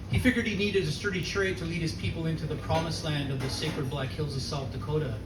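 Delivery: usual speaking voice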